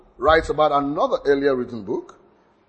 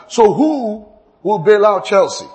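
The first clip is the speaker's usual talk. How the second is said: raised, louder teasing quip; jokey quip; 6 semitones higher, 6 dB louder than usual. Retold or imitated. Preacher jokingly voicing his own question, teasing. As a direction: toss out a cheeky football joke. Joke quote in a meeting example.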